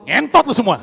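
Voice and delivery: loud crude shout at the crowd; pretend shout. Comic telling contestant to shout at the crowd, loud. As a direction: yell it at everyone. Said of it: "depan semua orang lu teriak".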